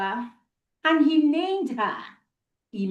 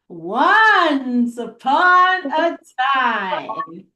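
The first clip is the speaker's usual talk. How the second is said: drawn-out story opening; 4 dB louder, much slower than usual. Acted. Repeating story opening for children.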